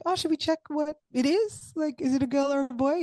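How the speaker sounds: bubbling with excitement to find out; giddy curiosity. Retold or imitated. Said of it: "we were like".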